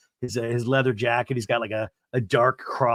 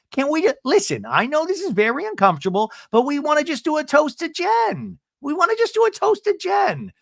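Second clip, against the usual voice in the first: much higher, sing-song coaxing; hammed-up high sugary voice; mock sweet plea; 14 semitones higher than usual. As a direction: coax like an overly nice hostess. Imitating Gina's sugary plea.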